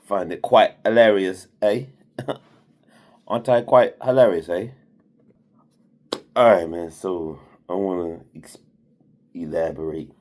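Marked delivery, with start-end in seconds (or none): 0.1-4.7 playful put-on Aussie accent, loud; mock Australian accent, punched up; British-Australian accent. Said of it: "but I like doing it".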